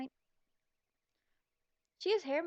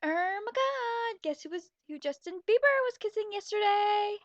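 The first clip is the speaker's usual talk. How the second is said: ordinary talk, nothing marked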